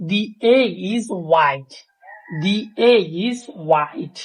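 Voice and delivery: "the" stretched to "thee", the sentence said very slowly. Shown as a demonstration. Teacher reading an example sentence slowly for students. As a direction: read it out slowly with a clear "thee". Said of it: "এখন আমরা কয়েকটা example দেখব".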